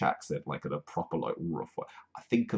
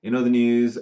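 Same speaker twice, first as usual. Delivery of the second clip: loud announcer; 4 semitones higher, 8 dB louder than usual. Acted. Segment title.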